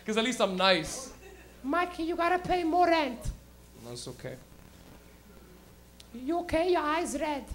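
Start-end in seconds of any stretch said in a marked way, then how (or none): 1.6-3.2 high mom voice; imitated mom. Mom to high son about rent, high-voiced. 3.9-4.4 low, very soft answer; stoned reply. Retold or imitated. Stoned son answers mom. 6.1-7.4 high, softer mother voice; imitated mom. Mom noticing son's red eyes, high-voiced. Acted mom checking on son.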